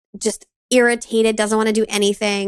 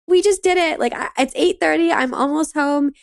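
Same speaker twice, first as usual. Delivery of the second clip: higher, thrilled report of success; triumphant; 6 semitones higher than usual. Retold or imitated. Wife telling husband on the phone about the win, elated. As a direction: crow a little about the win.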